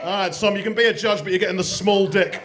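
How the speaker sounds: normal talking voice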